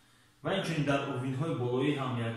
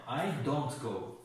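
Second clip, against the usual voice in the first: slow model sentence; much slower than usual. Shown as a demonstration.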